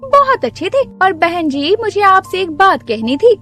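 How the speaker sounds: high, eager new-neighbour voice; chatty neighbour. Acted. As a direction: sweet-talk as the pushy new neighbour. Neighbour returns praising snacks.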